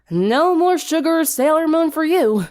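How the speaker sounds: playful scold; Applejack scolding. A narrator's reading.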